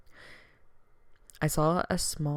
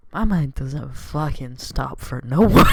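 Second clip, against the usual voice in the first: loud laughing rant; 6 semitones higher, 11 dB louder than usual. Real, not acted.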